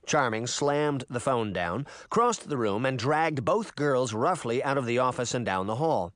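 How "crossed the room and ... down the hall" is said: ordinary delivery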